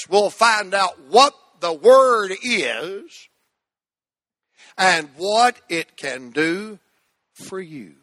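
slow, weighted phrase to repeat; slow recitation. Shown as a demonstration. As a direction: lay it out slow for the church. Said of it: "Say that with me".

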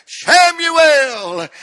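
loud, high call of the name. Retold or imitated. God calling the boy in the night, loud high call. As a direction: call out across the dark.

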